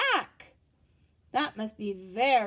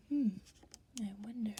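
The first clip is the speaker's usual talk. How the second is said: ordinary delivery, nothing marked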